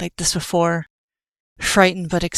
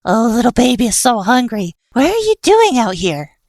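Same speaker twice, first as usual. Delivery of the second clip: higher, fond coo; baby talk; 5 semitones higher, 3 dB louder than usual. Acted. Killer coos over the hungry kitten, high and fond.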